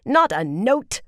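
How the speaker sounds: loud, high, sharp interruption; snapped order. A narrator's reading. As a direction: cut them off with a snap. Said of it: "Shanella snapped".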